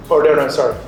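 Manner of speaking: ordinary talk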